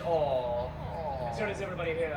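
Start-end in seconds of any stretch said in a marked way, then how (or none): 0.0-0.7 low cooing aw. Acted. King sees kitten.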